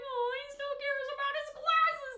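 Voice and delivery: moved, pitying voice on "glasses"; touched sympathy. Retold or imitated. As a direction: say "glasses" like it breaks your heart a little. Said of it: "knowing the context of why".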